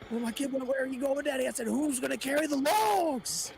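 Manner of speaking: high, loud mock Goggins; Goggins impression.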